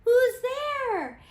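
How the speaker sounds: high, loud call into the dark; startled cry. Acted. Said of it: "Mouse cries".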